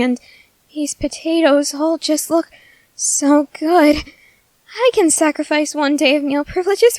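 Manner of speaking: normal talking voice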